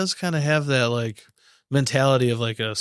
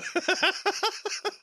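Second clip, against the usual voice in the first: a high breathy laugh dying off; 6 dB quieter than usual. Real, not acted. Cohost finishing a fit of laughter at his own joke, high laugh. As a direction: let the last laugh fade out. Still cracking up.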